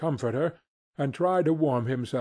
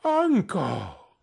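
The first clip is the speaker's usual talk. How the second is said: higher-pitched, imploring call; pleading; 5 semitones higher than usual. A narrator's reading. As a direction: plead with an exasperated uncle. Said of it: "pleaded the nephew".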